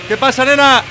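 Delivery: loud, high, cocky greeting; playful villain's hail; taunting shout. Pretend. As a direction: shout it at your cornered prey. Taunts a player on finding them.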